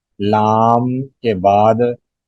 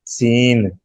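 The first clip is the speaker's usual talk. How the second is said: usual speaking voice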